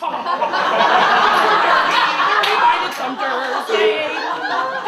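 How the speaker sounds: loud, high laughter; big laugh. Real, not acted. Comedian laughing hard at the dad's reaction. Laughing after dad line.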